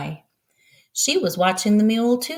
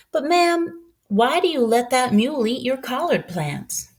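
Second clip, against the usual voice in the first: a hint of a young boy, higher; light boyish voice, a touch higher; lightly voiced boy; 7 semitones higher than usual. Narrator voices boy Alex.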